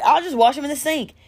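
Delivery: high, carefree offer; imitated breezy man. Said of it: "He's like".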